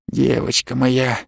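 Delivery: тихо, медленно, почти с нежностью; протяни ласково вполголоса; нежный шёпот. A narrator's reading. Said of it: "едва ли не с нежностью прошептал Саттор". (медленным нежным полушёпотом)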